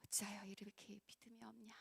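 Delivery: soft breathy whisper of 어찌하여 이렇게 믿음이 없냐; a hushed sorrowful whisper of the rebuke. Retold or imitated. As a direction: whisper the reproach as if close to their ear. Jesus's rebuke repeated, whispered.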